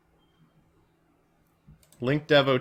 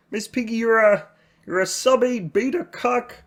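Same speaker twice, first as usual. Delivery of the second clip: Kermit preaching self-help to Piggy; Kermit impression; 9 semitones higher than usual. Kermit pleading with Miss Piggy, high frog voice. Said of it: "my Kermit impression is also my Jordan Peterson impression".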